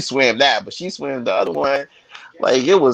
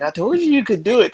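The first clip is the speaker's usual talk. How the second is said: proud dad; 11 semitones higher than usual. Retold or imitated. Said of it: "I was like".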